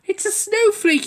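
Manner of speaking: high, slow delighted cry; cried happily. A narrator's reading. Noddy to Bumpy Dog, crying happily. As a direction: marvel at the first snowflake. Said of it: "he cried happily".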